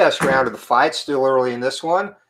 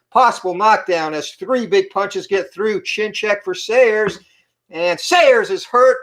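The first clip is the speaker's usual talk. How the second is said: excited; 6 semitones higher than usual. Real, not acted. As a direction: build the hype as he's hurt. Big knockdown moment in fight.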